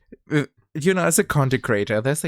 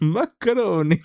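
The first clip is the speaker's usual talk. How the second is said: laughing; 8 semitones higher, 4 dB louder than usual. Real, not acted. Laughing at own clip.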